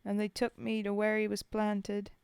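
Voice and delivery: put-on poem read in a rough British soldier's accent. Streamer reading Bill's Grave to chat, in a British soldier's accent. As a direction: tell it as a homesick Tommy at the grave.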